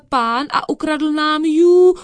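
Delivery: high, loud drawn-out wail; crying out. Acted. Reporting the stolen cat, crying out. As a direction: raise the alarm at full voice. Cat's theft cried out, story.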